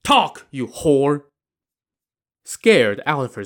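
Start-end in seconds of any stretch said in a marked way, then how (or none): 0.0-1.2 slow, forceful order; angry command. Acted. Brother forces kneeling widow to confess, angry command. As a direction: drag out each word as a threat.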